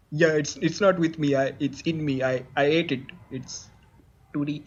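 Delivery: low, hesitant puppet guest; shadow musician guest. Acted. Plays the rock star's shadow guest.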